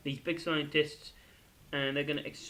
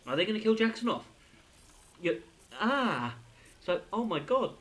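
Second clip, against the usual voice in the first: ordinary talk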